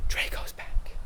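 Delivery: breathy dramatic whisper. Acted. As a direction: hush it like a secret reveal.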